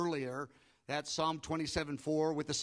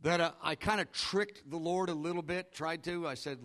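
ordinary talk, nothing marked

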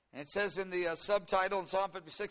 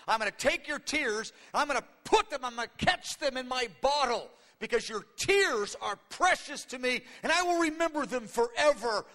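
higher, louder, emphatic pledge; fervent promise; 5 semitones higher, 6 dB louder than usual. Acted. God promising David to keep his tears, loud and fervent. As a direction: declare it with preaching force.

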